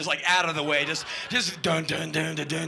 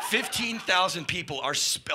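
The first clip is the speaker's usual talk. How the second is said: ordinary talk, nothing marked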